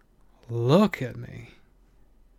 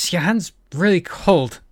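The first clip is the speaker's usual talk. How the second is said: laughing complaint; 7 semitones higher, 9 dB louder than usual. A narrator's reading. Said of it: "Kiva complained, laughing".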